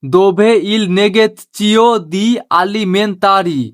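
very slow, word by word Italian phrase; slow teaching demo. Shown as a demonstration. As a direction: spell it out slowly for learners. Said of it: "Italian ভাষায় বলবেন". (ধীরে ধীরে শেখানোর উচ্চারণ)

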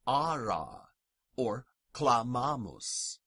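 ara and clamamus with long "ah"; ara, clamamus with drawn-out A; long A words. Shown as a demonstration. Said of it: "The long vowels in Latin".

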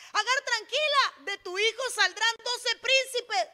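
loud, high proclaimed reassurance; acted emphatic promise. God promising Agar twelve princes, loud emphatic reassurance. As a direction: declare the promise with lifted voice.

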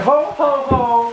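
high jolly ho ho; Santa ho ho ho. Acted. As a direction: laugh like Santa. Santa laugh.

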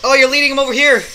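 loud high frantic yelling; frantic shouting. Real, not acted. Frantic shouting during attack.

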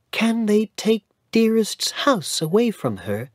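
put-on high child's voice, worried; worried little boy. Cedric asking his grandfather about Dearest, worried. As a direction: ask it anxiously like a small boy.

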